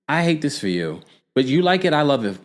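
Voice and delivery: mock sympathy. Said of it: "I was like".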